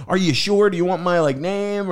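high-pitched, over-eager retold question; imitated anxious offer. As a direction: replay your worried politeness, pitched high.

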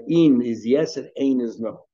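"in" with a long ee, "ein" with an ay sound; slow, clear contrast of "in" and "ein"; distinguishing pronunciations. Shown as a demonstration. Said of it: "So we usually pronounce".

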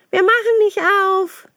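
little goats shouting no through the door; defiant goat kids. Acted. Kids refusing to open for the wolf, high and loud.

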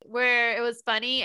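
ordinary delivery

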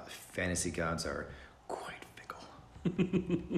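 hushed, drawn-out whisper with a laugh; mock whisper. To his co-host about a lucky rival, a mock whisper. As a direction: whisper it like a secret about the gods.

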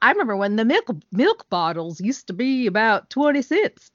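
put-on old-timer voice; hammed-up old-timer accent. Friend joking about feeling old, hammed-up old-timer accent. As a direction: reminisce in a mock old-person accent. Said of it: "That was a very offensive accent".

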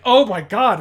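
loud, high gasped-out exclamation; stunned fan reliving the moment; retold shock. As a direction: cry out in sheer shock. Retelling shock at a stabbing.

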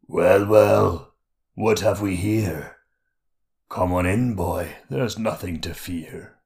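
a hint of a sly creature king; lightly voiced mocking monster king; Skurg King voice. A narrator's reading.

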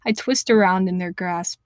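usual speaking voice

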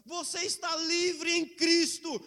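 loud, slow, fervent preaching; full force preaching. Acted. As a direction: proclaim it to the whole church.

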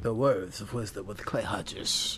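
slow, drawn-out announcer read; mock show intro, every word stretched; voiceover voice. Introducing his own Words of Wisdom segment, slow voiceover voice. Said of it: "You should do YouTube, like, voiceovers".

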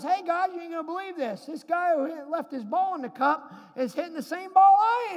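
excited call to the guys. Retold or imitated. Golfer shouting to friends about the ball in the cup. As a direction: call it out loud to the group. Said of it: "and he goes".

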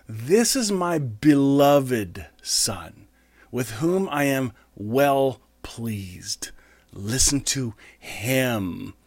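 very slow, hushed solemn reading; slow, half-whispered divine voice; slow reverent reading. Acted. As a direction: let each word of God land slowly. Reading God's voice slowly.

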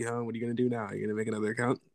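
ordinary delivery

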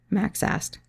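ordinary delivery

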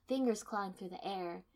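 ordinary delivery

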